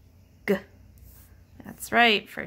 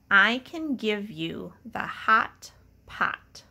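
slow dictation; slower than usual. Shown as a demonstration. Teacher dictates the sentence slowly for children to write. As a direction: read "I can give you the hot pot" slowly and clearly. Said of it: "the first sentence is".

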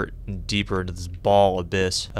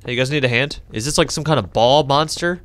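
normal talking voice

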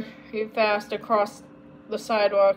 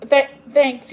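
louder, hearty call; yelled thanks; 3 semitones higher, 8 dB louder than usual. A narrator's reading. Samantha thanking the strawberry man, yelling it out. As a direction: call it out over distance. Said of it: "yelled Samantha".

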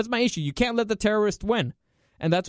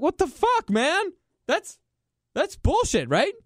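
much higher, outraged squeal of disbelief; indignant disbelief; 11 semitones higher than usual. Retold or imitated. Worker told he won't be paid, outraged high protest. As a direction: cry out like an unpaid worker who can't believe it. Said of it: "Be like".

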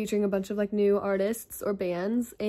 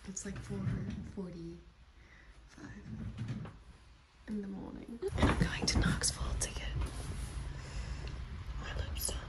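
early morning hushed whisper; 17 dB quieter than usual. Real, not acted. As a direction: whisper so nobody wakes.

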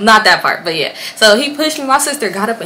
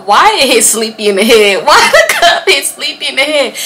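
louder, cracking up mid-sentence; real laughing disbelief; 5 semitones higher, 5 dB louder than usual. Real, not acted. Storyteller recalls the cup hitting the wrong man, laughing hard. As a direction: laugh through the words, incredulous.

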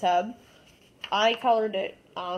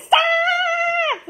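high, loud shouted stop; imitating brother yelling; 6 dB louder than usual. Kid imitating brother Skippy shouting at the toy. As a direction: yell like the annoyed brother. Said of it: "and he's like".

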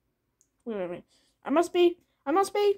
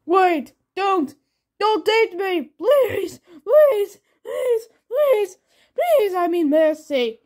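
high, loud desperate pleading; frantic begging; 6 semitones higher, 6 dB louder, much slower than usual. Acted. Blueberry begging not to be eaten, frantic and shrill. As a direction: beg for your life, voice cracking.

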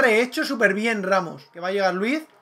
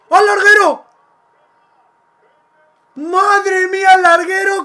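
high, loud cry at the crossbar; excited shout; 7 semitones higher, 6 dB louder than usual. Real, not acted. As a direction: shout it as the ball hits the bar. Shot hits the crossbar.

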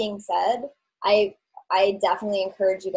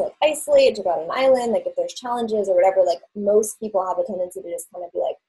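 usual speaking voice

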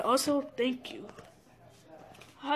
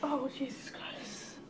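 exasperated whisper; 10 dB quieter, slower than usual. Real, not acted. Exasperated sigh over fake money.

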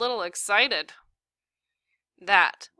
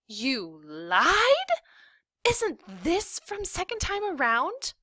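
put-on high, squawking kid's disbelief; squawky; 10 semitones higher, slower than usual. A narrator's reading. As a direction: voice shooting up in shock. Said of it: "Then squawkily".

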